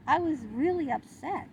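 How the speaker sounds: ordinary talk, nothing marked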